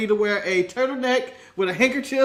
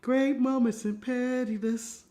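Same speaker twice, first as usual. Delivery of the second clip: mock segment title; 3 semitones higher, 6 dB quieter, slower than usual. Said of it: "announcing a segment title".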